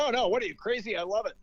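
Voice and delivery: high-pitched, amazed retort; incredulous scoff. Retold or imitated. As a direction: act out his disbelief, pitched well up. Dane scoffs at the question.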